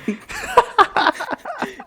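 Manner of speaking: shrieking laugh; loud high laugh. Real, not acted. Big laugh at funny story.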